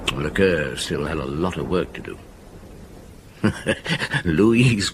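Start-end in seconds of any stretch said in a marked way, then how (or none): 3.4-4.2 short laugh. Acted. Narrator laughs about Lecoeur.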